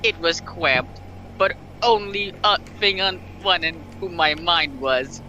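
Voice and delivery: normal talking voice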